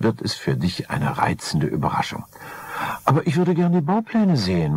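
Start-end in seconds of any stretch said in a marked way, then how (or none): 3.0-4.7 high, eager wifely voice; wife insisting. Acted. Wife asking to see the plans, high and eager. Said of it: "meinte sie".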